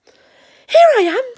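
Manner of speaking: high, loud, drawn-out squeak; Roo. Acted. Roo arriving to find Eeyore, high eager squeak. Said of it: "squeaked Roo".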